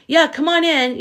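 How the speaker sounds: mocking high "come on in"; sarcastic welcome. Streamer mocking the agencies with a sarcastic welcome. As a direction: throw it out as a sarcastic invitation.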